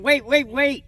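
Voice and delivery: high, loud urgent call. Retold or imitated. Boy calling after the little one running off, urgent shout. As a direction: shout after him to stop.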